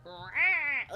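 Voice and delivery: loud, high cat snarl; mock territorial cat yowl; imitated angry cat. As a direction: let out a pretend cat growl. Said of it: "I don't know if she'll be like".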